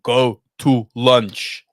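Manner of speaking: slow, drawn-out tough-guy line; lightly voiced De Niro, slow and heavy; a hint of gangster De Niro; De Niro impression. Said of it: "I'll have a De Niro and Copland moment".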